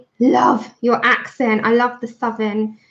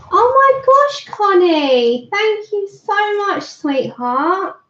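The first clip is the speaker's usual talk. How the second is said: delighted thanks; 9 semitones higher, slower than usual. Real, not acted. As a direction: gush with thanks at the surprise gift. Thanked for a super chat.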